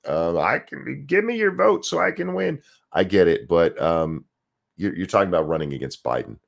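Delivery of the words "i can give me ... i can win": mock candidate plea. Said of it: "You gotta say that".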